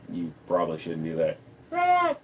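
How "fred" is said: very high squeaky put-on voice; Fred. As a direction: answer as a tiny, squeaky character. Fred repeats his name.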